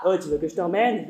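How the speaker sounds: a smirking offer from a creep; a man chuckling his sleazy offer to the cyclist; low leering man. Acted. Man in car harassing cyclist.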